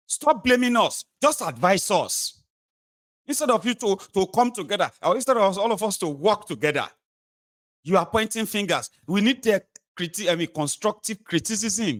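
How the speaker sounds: high-pitched defensive official; government pleading. Acted. Government answering the people, high-pitched pleading.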